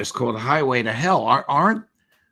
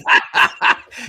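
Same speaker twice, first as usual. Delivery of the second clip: loud laugh; 9 dB louder than usual. Real, not acted. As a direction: burst of loud, breathy laughter. Host laughs at Lavrov's remark.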